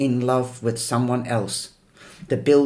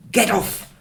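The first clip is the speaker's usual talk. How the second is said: high, sharp screech; 8 semitones higher, 4 dB louder than usual. A narrator's reading. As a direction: shriek it out in panic. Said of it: "I screech".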